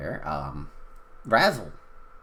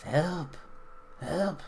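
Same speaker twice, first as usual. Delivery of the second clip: small voice calling for help; 8 semitones higher, 4 dB quieter than usual. Acted. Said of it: "a small voice. Goes".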